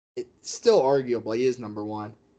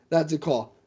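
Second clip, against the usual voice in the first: ordinary talk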